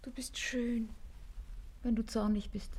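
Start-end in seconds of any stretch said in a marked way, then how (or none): 0.0-0.9 softly whispered "schön"; tender whispered compliment. Acted. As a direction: breathe it to him tenderly. Lover whispering to angry partner.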